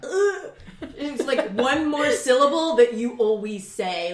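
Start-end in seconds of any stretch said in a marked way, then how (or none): 0.0-0.5 disgusted ugh. Retold or imitated. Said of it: "I'm like".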